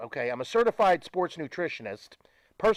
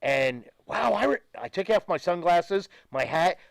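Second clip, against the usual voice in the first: ordinary delivery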